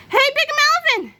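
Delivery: very high, loud call; shout. A narrator's reading. George rushes back and calls to Melvin, high shout. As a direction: shout to get his attention. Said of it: "shouted George".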